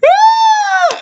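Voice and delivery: loud, very high-pitched mock shriek; piercing mock scream, drawn out; imitated high scream. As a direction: shriek like a shocked victim, hammed up. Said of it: "and he's like".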